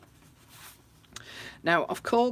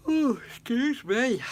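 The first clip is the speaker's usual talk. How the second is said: slowed, stretched words through a yawn; 8 semitones higher, 9 dB louder, slower than usual. Real, not acted. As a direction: say it while a yawn takes over.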